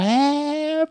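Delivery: very high mocking jeer; a hammed-up rowdy hoot; mock class jeer. Class jeering at teacher.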